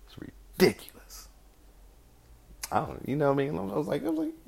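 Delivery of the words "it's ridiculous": higher whispered aside, slow; slow incredulous whisper. Real, not acted. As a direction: hiss it in disbelief. Aside about glove shaming.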